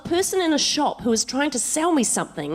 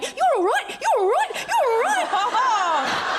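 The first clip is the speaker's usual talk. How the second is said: much higher, drawn-out reassuring voice; calm down a crying woman, nervously; soothing cop; 11 semitones higher, much slower than usual. Retold or imitated. Cop backing away from crying driver, soothing her. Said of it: "he backs out of the car going".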